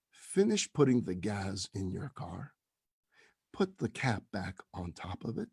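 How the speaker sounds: low, soft, measured instructions with pauses; calm reassurance. Retold or imitated. Rabbi directs the caller to his office, calmly reassuring.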